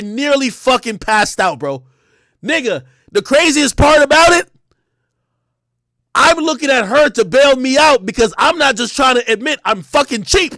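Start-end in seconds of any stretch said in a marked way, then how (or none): 2.4-10.5 higher and louder, worked up; rising panic as the price sinks in; excited storyteller. Real, not acted. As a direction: tell it like you can't believe it.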